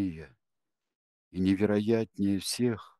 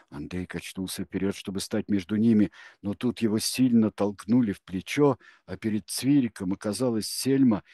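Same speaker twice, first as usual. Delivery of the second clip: usual speaking voice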